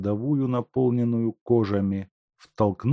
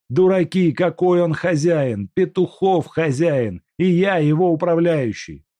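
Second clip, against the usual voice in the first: angry protest; 9 semitones higher, 7 dB louder than usual. Acted. Степанов кричит рабочим.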